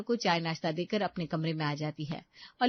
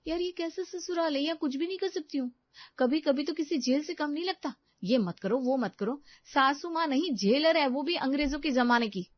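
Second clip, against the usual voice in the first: put-on slightly higher, complaining young wife; exasperated daughter-in-law; 7 semitones higher than usual. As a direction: gripe to your husband about the in-laws.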